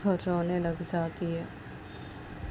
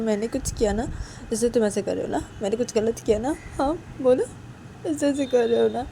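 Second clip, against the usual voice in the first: higher, insistent hurt questions; upset Uma; 6 semitones higher, 6 dB louder than usual. Acted. Uma asking Sanju why he acts this way, upset. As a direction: press anxiously for a reply.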